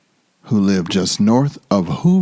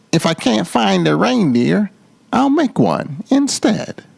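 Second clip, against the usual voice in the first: rising high with sly glee; gleeful Grinch; 8 semitones higher than usual. A narrator's reading. The Grinch, plotting aloud, gleefully voiced high. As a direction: let the Grinch's voice climb as the scheme clicks. Grinch plots a fake reindeer.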